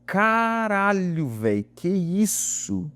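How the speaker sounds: drawn-out, stunned exclamation; espanto lembrado. Retold or imitated. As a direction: stretch it out like it just hit you. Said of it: "Eu falei". (espanto arrastado e incrédulo)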